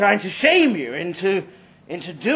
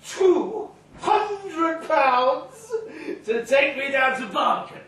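put-on drunken shout; 8 semitones higher, 3 dB quieter, slower than usual. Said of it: "He shouted, for the whole street to hear".

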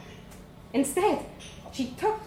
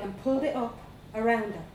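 usual speaking voice

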